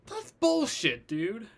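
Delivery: high-pitched, incredulous protest; disbelieving outburst. Acted. Disbelief at the punch boast.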